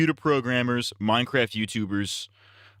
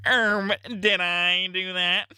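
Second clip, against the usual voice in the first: high, slow sitcom nerd impression; Steve Urkel; 8 semitones higher, much slower than usual. Sitcom nerd caught in the act, high Urkel impression. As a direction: draw out the catchphrase in a high put-on voice. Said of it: "Steve Urkel gets caught".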